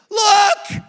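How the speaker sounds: loud high shout; wife holding up the pregnancy test; excited look. Retold or imitated. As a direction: thrust it at him.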